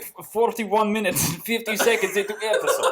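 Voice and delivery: put-on loud, high Russian-accented outburst breaking into laughter. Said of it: "in a Russian accent as intensely as you can".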